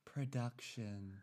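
slow, very soft; reviewer buzzword. Pretend. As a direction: drop the word slowly and softly. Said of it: "YouTube music reviewers".

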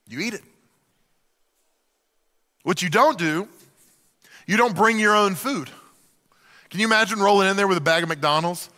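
ordinary delivery

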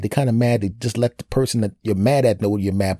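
ordinary delivery

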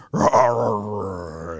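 half-hearted mock lion roar, fading out; lion roar impression. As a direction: playfully roar like the male lion chasing hyenas off. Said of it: "that's my impressions of it".

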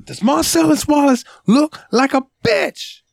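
very high, slow, strained mock shout; angry movie interrogation. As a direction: hammer each word like a furious interrogator. Acted Pulp Fiction interrogation quote.

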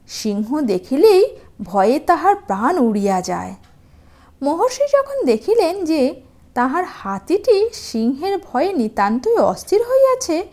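plain reading voice

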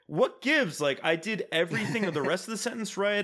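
retold indignant protest. Retold friend protesting her pronunciation.

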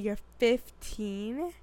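drawn-out, questioning "fifteen?" rising at the end; zdziwione niedowierzanie. Retold or imitated. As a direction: stretch it slowly into an incredulous question. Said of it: "not that I was like".

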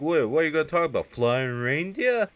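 mock incredulous. [name] scoffing at Joe's proposition, mock incredulous. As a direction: imitate a scoffing, disbelieving question.

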